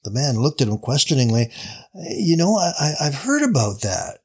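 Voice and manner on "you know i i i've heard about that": halting, stumbling start with repeated I; unsure, feeling for words; hesitant. Acted. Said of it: "he replied hesitantly".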